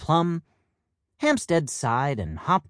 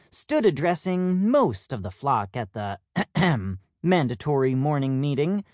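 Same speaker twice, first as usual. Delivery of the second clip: usual speaking voice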